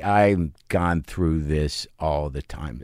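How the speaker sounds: slow, low and quiet resignation; weary exasperation. Retold or imitated. Voicing the wife who has seen it all, exasperated. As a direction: voice her worn-out exasperation, softly and slowly. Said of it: "that exasperated face of, like".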